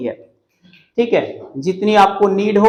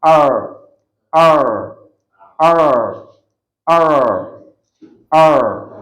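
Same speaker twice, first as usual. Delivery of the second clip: low, louder rolled R sounds, "err" trilled; rolled r like a crying baby; 5 semitones lower, 4 dB louder than usual. Shown as a demonstration. Teacher demos the R roll, a baby's crying "err". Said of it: "जैसे एक छोटा बच्चा रोता है".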